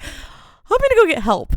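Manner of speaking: high, loud, urgent outburst; mock panic. Man seeing her broken leg, mock panicked. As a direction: imitate someone rushing off in alarm.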